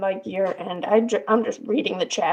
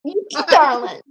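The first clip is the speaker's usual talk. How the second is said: higher, louder campy echo of the line; a teasing copy, pitched up and louder; 6 semitones higher, 4 dB louder than usual. Retold or imitated. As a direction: playfully parrot the camp New York voice. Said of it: "my gayest New York voice".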